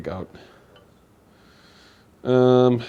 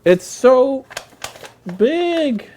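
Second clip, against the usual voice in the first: amazed; 14 semitones higher, 7 dB louder, much slower than usual. Real, not acted. Amazed at huge flour bag.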